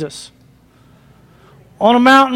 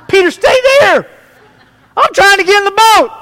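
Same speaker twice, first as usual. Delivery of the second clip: high, hammed-up protest; mock-panicked high call; comic calling out; 7 semitones higher than usual. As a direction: holler comically to a disciple to stay put. Joking acted Jesus calling to Peter.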